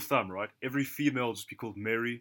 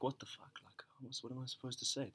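much softer, baffled aside; baffled under breath; 12 dB quieter than usual. Retold or imitated. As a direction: mutter it like a stunned thought. Retold baffled inner thought.

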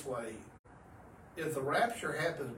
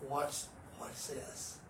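whispered question; 7 dB quieter, much slower than usual. Retold or imitated. Sneaking up close to bull.

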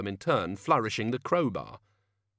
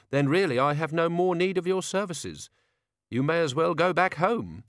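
higher, cool dismissal; Tea Time dismissive; 8 semitones higher, 3 dB louder than usual. Acted. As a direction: send him away politely.

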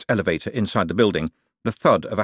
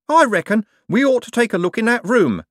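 high, loud, stubborn hostler; loud and wary; suspicious insistence; 14 semitones higher, 5 dB louder than usual. A narrator's reading. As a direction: insist on it, pitched right up.

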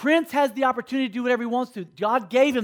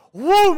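swooping whoosh; 7 semitones higher, 8 dB louder than usual. Retold or imitated. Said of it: "mama goes".

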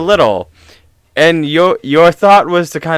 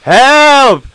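high, loud holler; mock scream for help; 10 semitones higher, 5 dB louder than usual. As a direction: scream for help in teasing imitation. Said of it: "what are you screaming".

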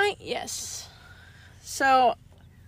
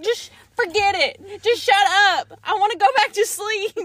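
raised, fed-up mock snapping; retold anger; 5 semitones higher, 3 dB louder than usual. Sleepy wife dismissing husband, mock irritated shouting. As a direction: brush it off crossly, higher and louder. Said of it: "getting more and more mad".

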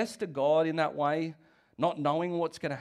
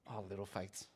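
cheeky pity; 10 semitones lower, 16 dB quieter than usual. Retold or imitated. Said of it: "as Jesus cheekily called his disciples".

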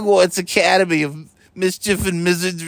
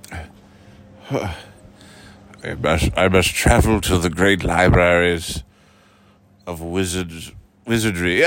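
Dumbledore voice; 11 semitones lower than usual. Pretend. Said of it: "Dumbledore figure, low voice".

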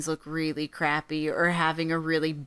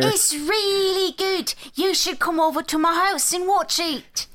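put-on British accent; 11 semitones higher than usual. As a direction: play an eager British fan.

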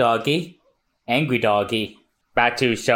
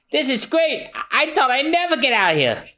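high, relieved exclamation; high-pitched acted delight; overjoyed; 13 semitones higher than usual. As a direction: celebrate getting free. Hero rejoices at rescue.

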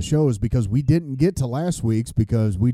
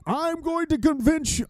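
mock preacher voice; 14 semitones higher, 3 dB quieter than usual. Mocking a preachy believer.

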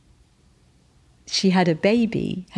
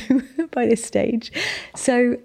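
a high laughing aside, much higher; laughing at herself; 7 semitones higher than usual. Real, not acted. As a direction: chuckle through it, self-mocking. Laughs at herself being a nutcase.

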